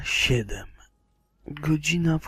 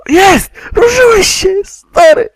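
very high, very loud cheer; ecstatic shout; 17 dB louder than usual. Real, not acted. Gamer cheering as his companion moves, ecstatic scream. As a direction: yell with joy and relief. Celebrates companion moving again.

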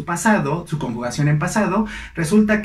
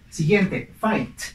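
normal talking voice

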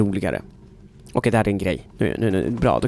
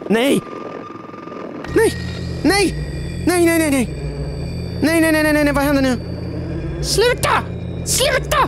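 terrified screaming. Real, not acted. Chased by something in the game, terrified screaming. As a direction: scream in real terror.

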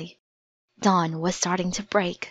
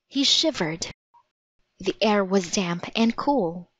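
plain reading voice

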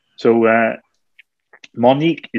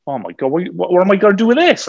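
panicked referee; 7 semitones higher, faster than usual. Retold or imitated. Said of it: "he was like".

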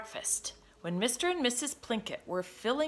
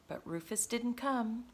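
plain reading voice

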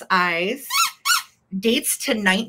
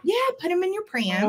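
much higher and quicker "pram"; playful pram repeat; 10 semitones higher, faster than usual. Real, not acted. Seller echoing her friend's new pram term, amused high quick delivery. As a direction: toss the pram line up high and fast, amused.

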